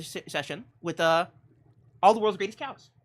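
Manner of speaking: normal talking voice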